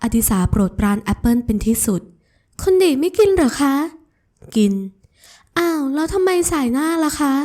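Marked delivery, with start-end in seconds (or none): 2.3-7.3 high, slow, doting voice; sweet young woman. Acted. Ramon offers Adisa an apple, high and doting.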